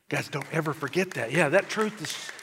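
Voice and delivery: normal talking voice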